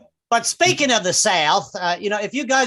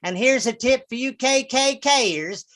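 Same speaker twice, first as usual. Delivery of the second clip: radio preacher announcing lineup. Acted. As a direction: imitate a folksy evangelist radio host. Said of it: "they give you the weekly lineup".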